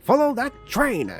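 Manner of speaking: high, loud yelling thug; overacted anime dub. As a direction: bark the order like a cartoon villain. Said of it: "said the heavier set one".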